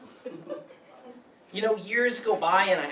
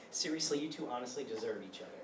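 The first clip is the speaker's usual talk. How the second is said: bitter quiet dismissal; 8 semitones lower, 14 dB quieter than usual. Acted. Betrayed friend's bitter dismissal.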